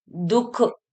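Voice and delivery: "दुख" with a breathy release; visarga h sound. Shown as a demonstration. Teacher showing the h coming out, breathed ending on "दुख". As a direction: say "दुख" as dukh, puff at the end. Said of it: "स्वर निकल रहा है इसमें".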